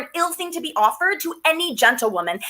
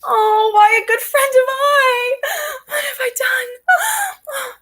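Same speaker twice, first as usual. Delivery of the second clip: wailing; 9 semitones higher than usual. Acted. Nurse wails in exaggerated lament.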